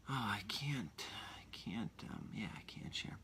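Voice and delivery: soft whispered aside; real whisper. Real, not acted. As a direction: hush it as if caught oversharing. Catches himself about to overshare.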